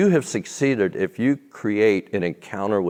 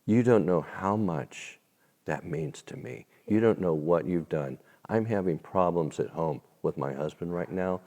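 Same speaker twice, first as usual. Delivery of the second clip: soft, hushed retelling; a hint of her emotion, quieter; quoting the moved waitress; 5 semitones lower, 7 dB quieter than usual. Waitress thanking him after the blessing, softly. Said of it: "she looks up afterwards and she says".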